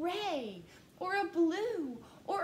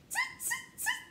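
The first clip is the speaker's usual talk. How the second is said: very high tiny tutting; high squeaky monkey voice; squeaky monkey tut. Acted. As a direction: chatter it like a cheeky monkey.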